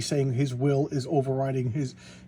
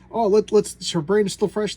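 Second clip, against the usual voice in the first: a mad doctor's eager raised voice; half-acted eager scientist; excited doctor; 7 semitones higher, 6 dB louder than usual. Doctor gleefully urges his team on.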